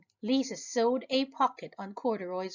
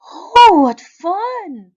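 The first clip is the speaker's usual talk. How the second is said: high, slow, much louder cry of joy; delighted squeal; 11 semitones higher, 17 dB louder, much slower than usual. Acted. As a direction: squeal with glee. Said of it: "he said with a smile".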